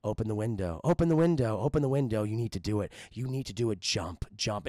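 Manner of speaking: low, insistent haunting voices; sinister low urging; voices in the head. Acted. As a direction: keep pressing him in a deep eerie tone. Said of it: "all these different voices coming".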